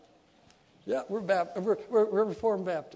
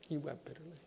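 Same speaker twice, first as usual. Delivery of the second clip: hushed repeat; 5 semitones lower, 15 dB quieter, faster than usual. Acted. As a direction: drop to a near-hush on the repeat. Second take, much softer.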